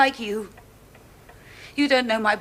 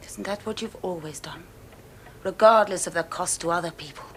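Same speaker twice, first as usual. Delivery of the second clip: soft, icy scorn; quiet contempt; 2 semitones lower, 11 dB quieter than usual. Acted. Cold contempt toward man.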